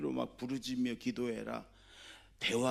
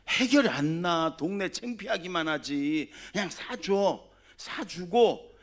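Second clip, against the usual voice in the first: louder and higher, pressing the point; loud insistence; 6 semitones higher, 7 dB louder than usual. Real, not acted. Preacher urging parents, louder and insistent. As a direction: drive it home to the parents.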